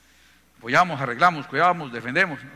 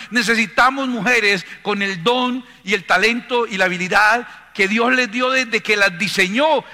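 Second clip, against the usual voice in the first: loud, higher preaching at full force; impassioned preaching; 6 semitones higher, 7 dB louder than usual. Acted. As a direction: build to a forceful, ringing exhortation.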